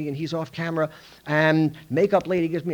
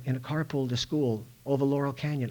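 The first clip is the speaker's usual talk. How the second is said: ordinary talk, nothing marked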